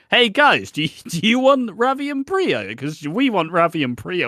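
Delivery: high, loud, eager pitch; hammed-up excited producer asking the crowd; mock-eager producers. Producers asking fans if they want Ravi and Priya, mock eagerness.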